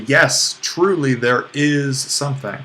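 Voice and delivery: emphatic yes, truly there is. Shown as a demonstration. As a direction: lean hard on "truly" with a falling close. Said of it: "we can use a very emphatic form".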